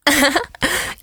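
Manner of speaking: high cawing cackle; harsh cackling laugh. Acted. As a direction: cackle like a crow. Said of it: "harsh as a crow's caw".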